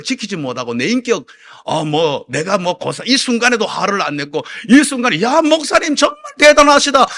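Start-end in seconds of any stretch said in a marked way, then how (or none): 5.2-7.1 high, loud mock admiration; imagined admiring praise. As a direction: gush over the pastor. 교인들의 감탄을 상상해 흉내. (과장되게 감탄하듯)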